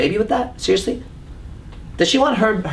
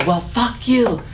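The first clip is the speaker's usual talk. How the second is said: put-on angry girlfriend; 7 semitones higher, slower than usual. Acted girlfriend storming off.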